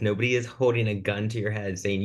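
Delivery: ordinary talk, nothing marked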